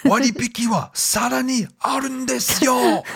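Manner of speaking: very high, fast TV salesman voice; Japanese shopping channel host. Retold or imitated. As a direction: hype it like a Japanese TV ad. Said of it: "they'd say something".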